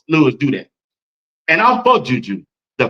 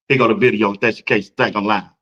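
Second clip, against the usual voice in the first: normal talking voice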